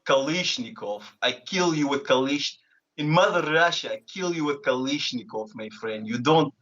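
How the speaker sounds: menacing mock Russian accent; thick stage-Russian accent. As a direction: play a Russian heavy making threats. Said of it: "Russian is Kalishnikov".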